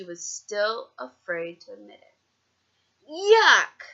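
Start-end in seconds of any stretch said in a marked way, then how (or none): 3.1-3.8 high, loud, disgusted yuck. A narrator's reading. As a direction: spit it out like a stubborn kid. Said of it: "she said".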